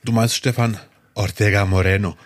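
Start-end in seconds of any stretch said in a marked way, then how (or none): 1.1-2.2 exaggerated fake Spanish accent; pseudo-Spanish. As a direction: roll the name like overdone Spanish. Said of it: "Ich habe jetzt extra ein bisschen übertrieben".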